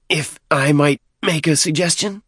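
high-pitched, deferential petitioner; persistent deacon. A narrator's reading. Deacon asking to make a suggestion, politely persistent. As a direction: press the request in a high voice. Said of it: "Dadab persisted".

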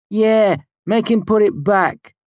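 high, loud, slow heckle; jeering onlooker. A narrator's reading. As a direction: chime in from the crowd, mocking. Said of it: "One of the onlookers chimed in".

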